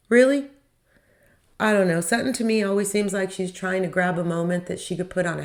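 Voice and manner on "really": high, loud disbelieving "Really?"; a real jolt of disbelief; sharp, surprised rise; incredulous. Real, not acted. Incredulous reaction to catchphrase.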